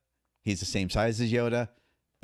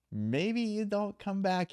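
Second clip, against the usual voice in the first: put-on much higher, slow Thai accent; imitating Thai trainer, worried; 10 semitones higher, slower than usual. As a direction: warn him gently in the trainer's accent. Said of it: "He goes".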